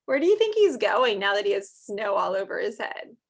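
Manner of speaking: ordinary talk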